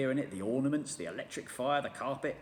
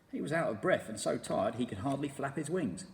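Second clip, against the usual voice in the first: ordinary delivery, nothing marked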